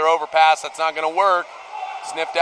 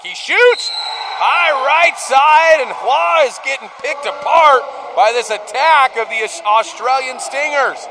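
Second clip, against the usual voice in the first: excited shot call; 6 semitones higher than usual. Real, not acted. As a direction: erupt as the shot goes in, then ride the high. Shot on goal called live.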